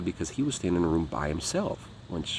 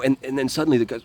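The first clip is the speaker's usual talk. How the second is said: ordinary talk, nothing marked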